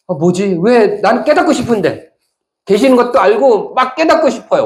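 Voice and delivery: high, loud pleading bewilderment; frustrated acted longing. Preacher voicing seekers who long to realize, frustrated high plea.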